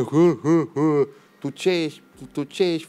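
put-on slow, jeering taunt pointing like a child; mocking bully. Aggressor taunting a friend on the escalator, mocking jeer. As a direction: sneer it out like a mall bully. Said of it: "Și spunea".

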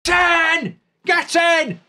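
high, excited yelling at a goal; elated goal cheer. Real, not acted. Goal celebration, yelling at screen.

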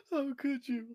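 high shocked gasp then cry; hammed-up betrayed gasp; mock gasp. Mock outrage at epic ban.

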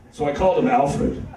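ordinary talk, nothing marked

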